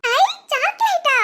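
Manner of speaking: very high, drawn-out delight; delighted child. Acted. Child hearing father brought chocolate, delighted. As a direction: squeal like an excited little kid.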